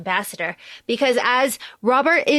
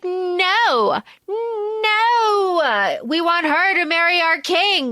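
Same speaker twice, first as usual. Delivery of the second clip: high, long-drawn "no"; mock a crowd refusing outright, stretching the vowels; higher-pitched, exaggerated group protest; drawn-out mock refusal; 6 semitones higher, much slower than usual. Voicing the crowd refusing the proposal.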